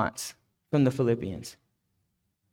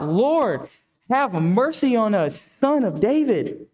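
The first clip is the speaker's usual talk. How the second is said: high, pleading call, only mildly raised in volume; crying out for mercy; 6 semitones higher, slower than usual. Acted. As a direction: call out to the Lord as a beggar would. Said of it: "they cried out".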